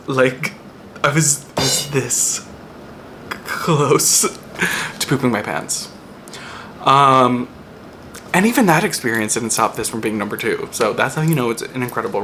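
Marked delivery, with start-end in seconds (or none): none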